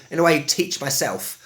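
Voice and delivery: normal talking voice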